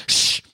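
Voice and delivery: sharp audience shush. Acted. Audience member behind him at the fishbowl show, shushing. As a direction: shush someone talking during a show.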